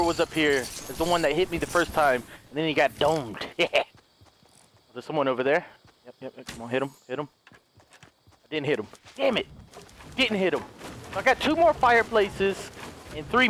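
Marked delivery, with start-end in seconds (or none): 6.0-7.3 low, very soft coaxing; will the shot home under your breath; hushed urging. Real, not acted. Gamer aiming at someone far away, hushed urging.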